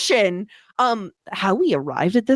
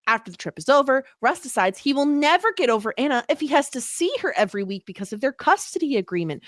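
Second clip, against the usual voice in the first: ordinary talk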